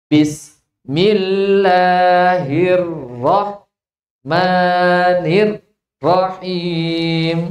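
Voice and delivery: "Bismillahirrahmanirrahim" chanted very slowly, cut into pieces; broken recitation. Shown as a demonstration. Teacher imitating a choppy reading of the basmala. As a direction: recite "Bismillahirrahmanirrahim" very slowly with pauses mid-word. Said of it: "kalau ada orang membaca seperti ini".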